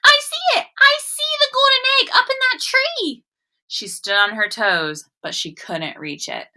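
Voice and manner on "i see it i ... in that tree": high, loud excited cry; excited shout. Acted. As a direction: shout it out like a thrilled little girl. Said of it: "Lola stopped running and shouted".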